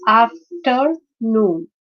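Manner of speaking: "afternoon" slowly as af-ter-noon; syllable by syllable. Shown as a demonstration. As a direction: break "afternoon" into three parts. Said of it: "this is a three-syllabic word".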